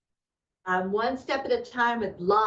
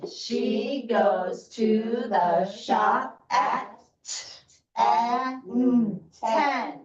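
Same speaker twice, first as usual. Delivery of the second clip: very slow, word by word; slow choral reading; 3 dB louder, much slower than usual. Shown as a demonstration. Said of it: "so it's gonna go like this".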